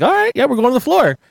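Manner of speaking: high, gleeful agreement; gleeful acceptance. Retold or imitated. Teller thrilled at free floor seats, high gleeful. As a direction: cheer it like winning.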